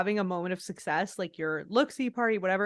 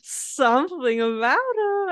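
slow, higher, drawn-out tipsy Lisa; echoing the host's tipsy line, slow and higher; imitating Lisa again; 6 semitones higher, 5 dB louder, slower than usual. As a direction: stretch it out, dreamy and a bit drunk.

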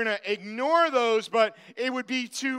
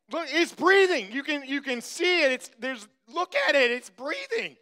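higher-pitched, exasperated pleading; mock urgent insistence; 6 semitones higher than usual. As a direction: act out a kid arguing his point. Retold argument, he insists it breathes.

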